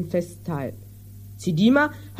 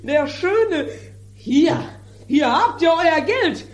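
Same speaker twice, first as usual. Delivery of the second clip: a higher, agitated stop-start voice of a furious farmer; irate landowner; 6 semitones higher than usual. Acted. Landowner fuming at the mended jar, agitated and higher. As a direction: sputter with outrage over the broken jar. Don Lollo rages at ruined jar.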